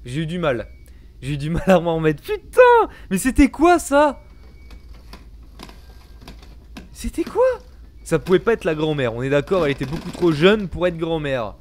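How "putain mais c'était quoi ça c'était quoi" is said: shaken, high and slow; panicking gamer. Real, not acted. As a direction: still rattled after scare. After scare, shaken.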